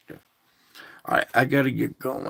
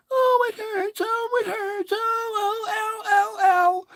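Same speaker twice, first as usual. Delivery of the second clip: very high, whiny sing-song moaning; hammed-up self-pity wail; mock whining. Mocking a pity party with high sing-song whining.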